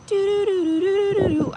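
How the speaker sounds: high, loud string of doo-doos; imitated doo-doo tune. Mimicking what the odd cooking channels seem like, high loud doo-doos. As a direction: trill out a silly high tune.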